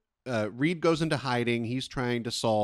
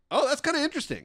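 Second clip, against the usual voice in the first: lightly acted high-pitched interest; mock intrigued; much faster than usual. Host recalling hearing the body horror pitch, mock intrigued. Acting out own earlier intrigued reaction.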